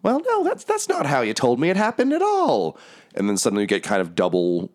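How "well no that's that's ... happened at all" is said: very high-pitched character voice; put-on high voice, objecting; Lulu. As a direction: protest in a squeaky high voice. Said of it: "Lulu's voice just go".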